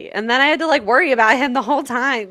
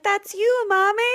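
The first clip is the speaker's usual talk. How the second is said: put-on child voice; 12 semitones higher than usual. Voicing a child in example.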